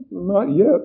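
high, loud, nervous reply; jumpy answer, lightly stammered; stammering. A narrator's reading. Frightened man answering the host at the front, stammering. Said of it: "the man stammered".

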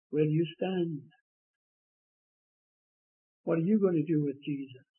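usual speaking voice

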